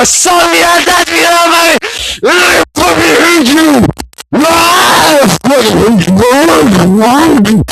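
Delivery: high, drawn-out shouted rage; theatrical howl of hatred; hammed-up scream. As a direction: scream it like a tragic breakdown. Melodramatic screaming climax.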